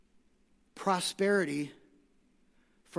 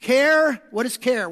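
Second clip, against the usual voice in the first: normal talking voice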